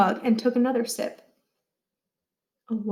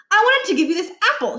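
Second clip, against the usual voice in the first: put-on eager kid; 9 semitones higher, 3 dB louder, faster than usual. A narrator's reading. Niles offers an apple.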